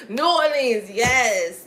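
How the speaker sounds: loud, delighted burst of remembering; excited recall. Real, not acted. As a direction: cry it out as it finally comes back. Suddenly remembers the forgotten name.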